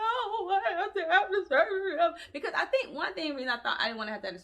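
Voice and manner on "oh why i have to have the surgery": high, wailing, half-laughing sob; mock boo-hoo crying. To the surgeon after bad news, crying high through laughter. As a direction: whine it like a blubbering kid. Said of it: "I started boo-hooing".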